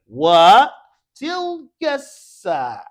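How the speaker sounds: loud, slow model for repetition; stretched-out, emphatic classroom call; calling out a drill. Shown as a demonstration. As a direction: project it slowly to the class.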